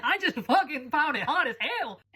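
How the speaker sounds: slow, high, swooping emphasis; emphatic gushing. Real, not acted. Insisting no one forced her to like the accent, slow high gushing. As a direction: stretch it out with relish.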